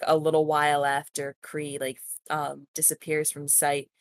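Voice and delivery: ordinary delivery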